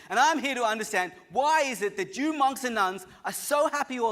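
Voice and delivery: loud, high indignant demand; loudest voice. Retold or imitated. Psychiatrist yelling at the silent monk, indignant and loud. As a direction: shout it like an offended big shot. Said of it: "he says in his loudest voice".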